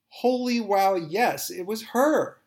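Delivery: loud, high burst of amazed recognition; excited disbelief. Acted. Narrator seeing the photo, shouting in amazed recognition. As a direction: shout it out, stunned that it's really her.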